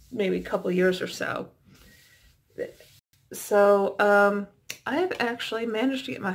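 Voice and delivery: stiff cheek and jaw; talking with sheet mask on. Real, not acted. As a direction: lips framed by mask.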